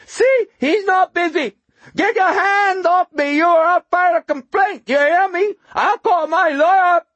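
very high, loud, grating screech; shrill, whining homeless man. A narrator's reading. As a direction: rant shrilly in indignant protest. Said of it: "the shrill whine of the homeless man particularly grating".

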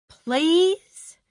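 high, loud pleading please. Acted. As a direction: beg him with a high please. Wife pleading with husband.

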